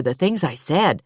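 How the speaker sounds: plain reading voice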